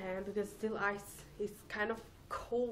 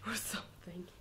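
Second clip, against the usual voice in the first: whispering; 3 semitones lower, 8 dB quieter than usual. Real, not acted. Whispered aside after laugh.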